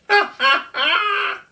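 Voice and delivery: high loud mocking ha ha ha; laughing. Kookaburra laughing at the crying kangaroo, high and loud. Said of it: "laughed Colin".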